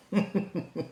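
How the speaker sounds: high, quick chuckle; short giggle before talking; chuckling. Real, not acted. Speaker finds the politician ridiculous, chuckling.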